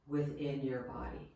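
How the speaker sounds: soft, calm Yoga Nidra guidance with long pauses; guided meditation. Real, not acted. Yoga teacher leading listeners into rest, guided meditation voice. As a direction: guide them gently, leaving space after each phrase.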